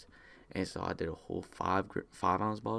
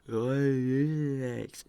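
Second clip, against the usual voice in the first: very drawn-out, high, loud druggy voice; stoned reply; 7 semitones higher, 7 dB louder, much slower than usual. Retold or imitated. Him high, answering grandpa, stoned drawn-out reply. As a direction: stretch it way out, high and dopey.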